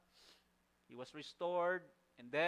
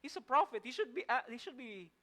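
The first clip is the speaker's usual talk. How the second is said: raised high, louder, driving the point home; indignant preaching; 8 semitones higher, 5 dB louder than usual. Real, not acted. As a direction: preach it with rising disbelief. Preacher stressing Jonah's shocking anger.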